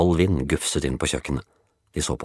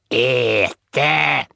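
woodpile voice; 9 semitones higher, 4 dB louder than usual. Acted. Woodpile speaks.

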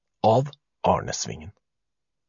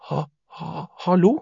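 higher voice, feeling out the dark; tentative attempt; 7 semitones higher than usual. A narrator's reading. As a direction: stammer out a timid, questioning hello. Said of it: "forsøkte Bendik".

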